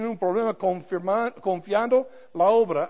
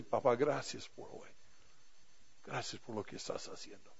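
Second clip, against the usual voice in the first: tender whisper; 6 semitones lower, 13 dB quieter than usual. Retold or imitated. Daughter thanks father at wedding.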